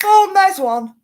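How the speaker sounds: high, loud exclaimed thought; excited discovery. Retold or imitated. Host spots a clue in the remark, acted excitement. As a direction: pounce on it, acting out the realisation. Acts out spotting a hidden message.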